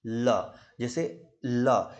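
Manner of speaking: ल stretched very slowly, tongue on the gums; slow l demo. Shown as a demonstration. Teacher showing students where ल is made. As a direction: hold ल long and clear. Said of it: "ल आप खुद बोल के देखो".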